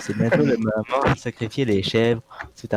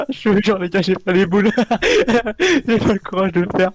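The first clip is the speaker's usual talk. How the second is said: nervous laughing; 6 semitones higher than usual. Real, not acted. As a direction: laugh through your fear.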